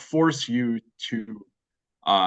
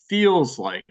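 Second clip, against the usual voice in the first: feels pushed up high and loud; stress on feels; 5 semitones higher, 4 dB louder than usual. Real, not acted. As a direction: lean hard on the word feels, higher and louder. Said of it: "I emphasize the word feels very strongly".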